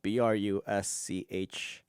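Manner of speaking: slow letter-by-letter "B R U S C H"; spelling out letters. Shown as a demonstration. Vlogger spelling the word for viewers, letter by letter. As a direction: spell each letter out slowly. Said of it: "It's B".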